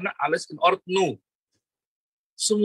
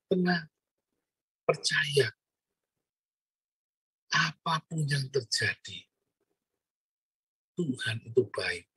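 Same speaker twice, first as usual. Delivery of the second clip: calm reassurance; 3 semitones lower, 6 dB quieter, slower than usual. Acted. Preacher calming the frightened.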